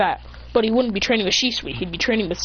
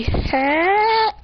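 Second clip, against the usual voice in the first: high, rising, stretched hello; acted group greeting; 10 semitones higher than usual. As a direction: greet brightly on a climbing pitch. Group greets the hero in chorus.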